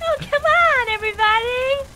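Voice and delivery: high, loud and bouncy cheer; cheery SpongeBob voice. Retold or imitated. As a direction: play an overly happy cartoon. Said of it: "Just like".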